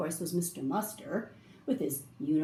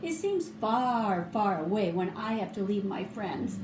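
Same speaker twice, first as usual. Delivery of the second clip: ordinary delivery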